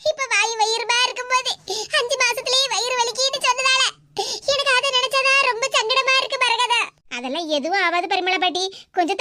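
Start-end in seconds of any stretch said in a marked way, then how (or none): 0.1-6.8 put-on older woman's fretful voice, pitched up; fretting over her pregnant daughter-in-law; worried grandmother. Grandmother worrying to Maragatha in hospital, fretful.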